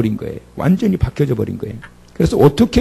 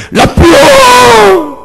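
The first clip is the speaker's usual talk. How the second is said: very high, loud, stretched cry of recognition; joyful cry; 10 dB louder, much slower than usual. Acted. Mary cries out to the risen Jesus, high and loud. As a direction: burst out with overwhelming joy.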